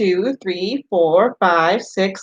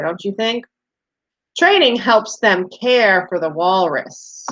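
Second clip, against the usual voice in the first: usual speaking voice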